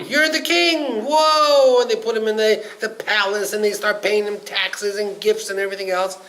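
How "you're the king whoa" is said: much higher and louder, cheering him as king; excited crowd cheer. Acted. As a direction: hail the new king with delight. Islanders proclaim castaway king.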